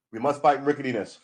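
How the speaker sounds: rally the troops, hammed up; mock rallying cry.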